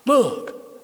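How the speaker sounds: high, loud "look!"; sudden loud exclamation, mimicking his wife; excited pointing out. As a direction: call out as if spotting a celebrity. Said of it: "and there she goes".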